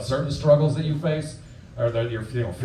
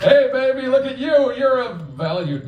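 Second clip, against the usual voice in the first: catcalling worker; 12 semitones higher, slower than usual. Retold or imitated. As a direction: start a catcall, then swerve prim. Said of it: "He was like".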